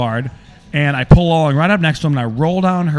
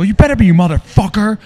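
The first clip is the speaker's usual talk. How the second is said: loud, raised-pitch retort; angry; 7 semitones higher, 6 dB louder than usual. Retold or imitated. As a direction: re-enact snapping at the other driver in rage. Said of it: "And I'm so angry".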